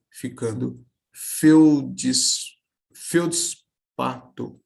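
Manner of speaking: feudispato slow, feldis-pa-to with no extra i; slow demo of the written division; written syllable split. Shown as a demonstration. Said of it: "se junta à sílaba anterior".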